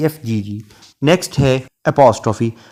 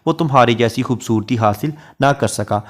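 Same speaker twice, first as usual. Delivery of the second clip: ordinary delivery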